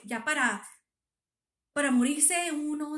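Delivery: exasperada. Retold or imitated. La doctora exagera lo grave.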